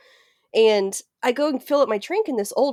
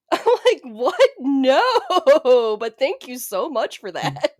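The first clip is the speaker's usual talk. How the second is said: laughing disbelief, a bit louder; 5 semitones higher, 5 dB louder, much slower than usual. Real, not acted. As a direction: laugh through the thanks. Laughing at being flattered.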